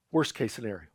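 dropped low and soft, muttered to himself; quiet aside. Shown as a demonstration. Preacher repeats the phrase to the congregation, quiet and low. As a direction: let it fall away under the breath. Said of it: "preacher repeats phrase as aside".

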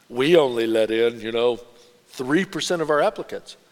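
a hint of a snooty university boasting; haughty admissions office. Voicing an exclusive university.

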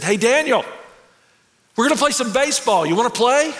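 a lightly voiced older kid calling out. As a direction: hail him across the playground. Older boys calling out to him.

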